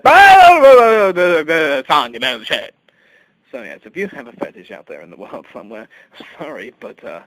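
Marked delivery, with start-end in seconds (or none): 0.0-2.7 very loud high shout; father's shout. Retold or imitated. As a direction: bellow it from the hospital bed. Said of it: "and he said".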